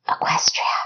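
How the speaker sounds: breathy whisper of a name. Acted. Kessa warning Equestria in a whisper. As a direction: whisper so no one hears. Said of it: "she whispered".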